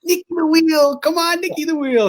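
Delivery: very high cheering yell; imitating yelling. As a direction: cheer the driver on up the muddy hill. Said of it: "John was just kept yelling".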